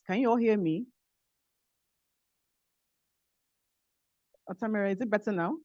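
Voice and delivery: faint voice checking the line; weak, distant speech; barely audible. Real, not acted. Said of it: "your voice is very low".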